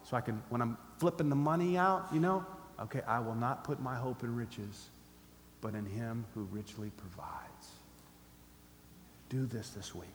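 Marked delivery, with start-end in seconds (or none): 3.1-7.7 soft, low private recitation; hushed, slowing self-reminder; repeating to oneself. Shown as a demonstration. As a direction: say it quietly to yourself, fading near a whisper. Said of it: "repeat this verse to yourself".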